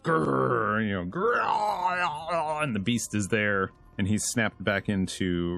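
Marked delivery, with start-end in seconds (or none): 0.0-0.9 short, higher-pitched mock growl; imitated beast growl. Reviewer imitates the beast Kratos hears, short mock growl. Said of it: "he hears the roar of the beast". 1.0-2.6 long, high drawn-out mock roar; imitated beast roar. Reviewer imitates the Chaos Beast, long hammed-up roar. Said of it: "he hears the roar of the beast".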